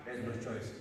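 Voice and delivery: ordinary talk, nothing marked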